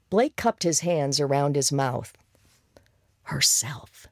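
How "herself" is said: breathy whisper, lips close. Acted. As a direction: whisper it like a secret. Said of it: "he whispered".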